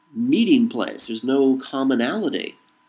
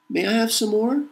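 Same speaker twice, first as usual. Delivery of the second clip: much higher, rising timid plea; pleading beggar; 11 semitones higher than usual. Pretend. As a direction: ask like a hungry orphan begging. Begging plea, Oliver Twist line.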